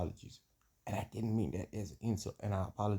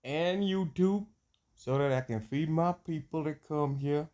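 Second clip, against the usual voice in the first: put-on American drawl; 4 semitones higher, 5 dB louder than usual. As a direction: play a loud American homeboy in a put-on accent. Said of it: "when I get this here accent".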